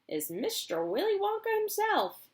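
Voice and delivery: put-on high proud old man; proud announcement. Grandpa Joe introduces Wonka, proud. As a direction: present him grandly.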